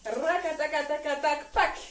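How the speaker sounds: rapid "rakataka" rattle, then a dry "pac"; rhythmic vocal drumroll. Shown as a demonstration. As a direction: sound out the trembling, end on a sharp hit. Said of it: "ce qui va donner".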